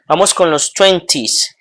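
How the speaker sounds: ordinary delivery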